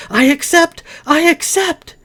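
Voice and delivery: Lily crying out in delight; ecstatic cry. A narrator's reading. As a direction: burst out with joy. Lily's rising joy.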